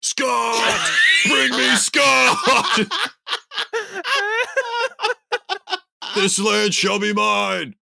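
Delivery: high, slow bellowing challenge; mock warrior shout. Shirtless Brock on the tarmac, bellowing a challenge. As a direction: roar it like a conquering warlord. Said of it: "and is like".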